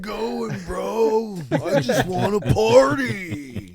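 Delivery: high, slow, drawn-out party bro; frat bro. Acted. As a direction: whine it like a goofy bro. Voicing zombie frat boys chasing.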